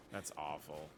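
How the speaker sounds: ordinary talk, nothing marked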